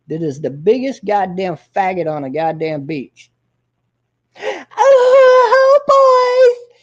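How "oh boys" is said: very high, loud shrieking mock cry; a shrill mocking falsetto burst; mock falsetto squeal. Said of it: "And he even runs like that".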